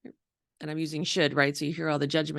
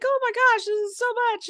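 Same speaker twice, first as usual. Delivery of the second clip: much higher mock overwhelmed voice; hammed-up overwhelm, pitched way up; overwhelmed self. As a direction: squeak it out as if swamped by love. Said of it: "it's like".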